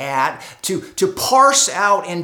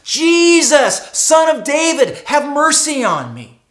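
pleading cry, higher and louder; the beggar crying out again, louder; 6 semitones higher, 6 dB louder than usual. Acted. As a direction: call out again, more desperate. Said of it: "begins to cry out to Jesus".

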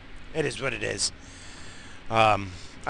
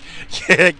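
loud burst of laughter; laughing; 5 semitones higher, 8 dB louder than usual. Real, not acted. Laughs after joke.

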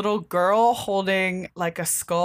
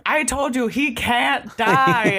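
ghost voice; 5 semitones higher, slower than usual. Acted. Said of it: "And then the spirit's like".

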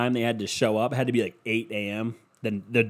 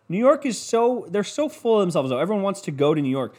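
ordinary delivery

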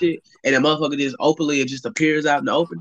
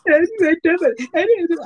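high mocking squeak, a bit louder; mocking her; 12 semitones higher, 4 dB louder than usual. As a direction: ape her voice to tease her. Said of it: "I was mocking her".

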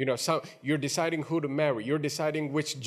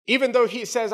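normal talking voice

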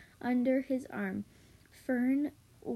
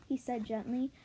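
ordinary delivery, nothing marked

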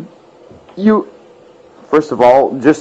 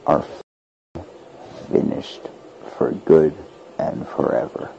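whispered; 12 semitones lower, 11 dB quieter than usual. Real, not acted. Ominous warning about the elite.

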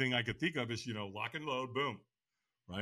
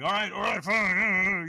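grudging give-in; 6 semitones higher, 6 dB louder, slower than usual. Retold or imitated. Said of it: "And so I'm like".

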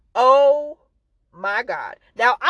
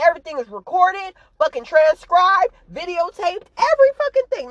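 higher, heated rant building to a peak; getting more worked up with every line; worked-up yelling; 7 semitones higher than usual. Real, not acted. Ranting to viewers about a trial, heated and rising. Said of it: "I'm sorry, y'all, if I'm yelling".

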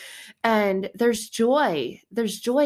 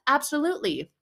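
ordinary delivery

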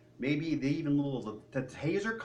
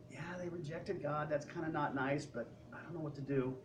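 softer, unsure shrug of a voice; sheepish Andrew; 9 dB quieter than usual. Retold or imitated. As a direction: mumble with a helpless sigh. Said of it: "Andrew may have been back there going".

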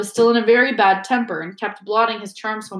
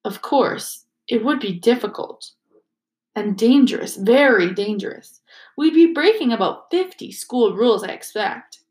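ordinary delivery

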